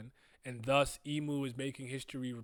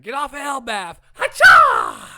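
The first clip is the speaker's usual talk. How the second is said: angry yelling; 10 semitones higher, 23 dB louder, slower than usual. Acted. Yells manga fight lines.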